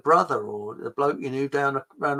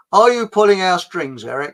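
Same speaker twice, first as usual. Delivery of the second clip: loud teasing call; 4 semitones higher, 9 dB louder than usual. Acted.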